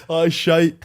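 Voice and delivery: laughing Scottish "Oh, shite"; mock Scottish barman. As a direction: put on the barman's Scottish accent. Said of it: "and he said".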